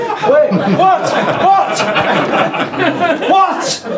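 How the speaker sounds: very high-pitched, alarmed questioning; mock panic, voice shooting right up; panicked bafflement. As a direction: squeal it in comic alarm. Acts out panic at cryptic whisper.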